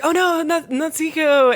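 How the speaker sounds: high-pitched cry of dismay; mock alarm. Retelling her seeing Natsuhiko pulled away, mock alarm. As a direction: call out his name in high mock alarm. Retelling girl calling after him.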